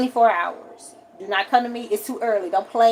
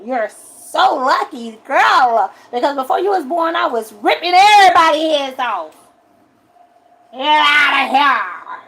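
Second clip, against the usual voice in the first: mock fierce boast; 4 semitones higher, 4 dB louder than usual. Telling daughter about past anger.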